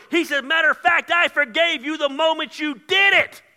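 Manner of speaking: rhythmic build from measured to shouted; full-force preaching. Real, not acted. Preacher tells the guilty God forgave them, building to shouts. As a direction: drive the list relentlessly up to a high shout.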